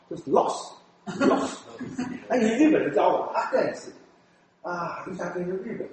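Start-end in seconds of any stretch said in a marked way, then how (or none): 0.1-1.6 rose pronounced loss, slow and whispered. Shown as a demonstration. Showing Taiwanese R becoming L, slow whispered loss. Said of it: "就发成F".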